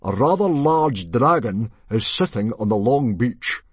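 big declaiming announcement; booming chief. A narrator's reading. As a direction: boom it out to the whole hall. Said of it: "boomed Stoic".

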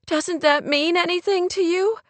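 high, pained challenge; upset friend. A narrator's reading. Kate challenges Rory about Clay's love, upset.

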